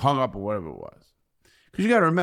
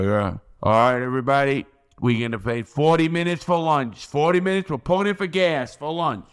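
PA announcement; 5 semitones higher, 5 dB louder than usual. Retold or imitated. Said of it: "over the P A, they go".

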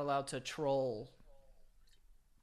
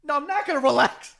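high, loud mock refusal; hammed-up refusal; 10 semitones higher, 12 dB louder than usual. Stabbed victim refusing to relax, high loud mock refusal. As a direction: yell the refusal in play.

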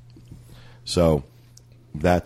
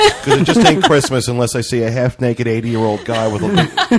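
laughing; 6 semitones higher, 8 dB louder than usual. Real, not acted. Joking about half-naked Santa.

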